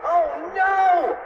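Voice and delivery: high, loud, drawn-out cry; shouted alarm. A narrator's reading. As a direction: shout it in cartoon panic, stretching the vowel. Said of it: "shouted Cheeseball".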